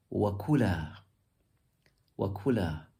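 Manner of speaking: "Wa kula" with the ku stressed; "Wa kula", ku emphasized, falling after it; stress on second syllable. Shown as a demonstration. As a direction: lean on the ku in "Wa kula". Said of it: "to emphasize the kaf".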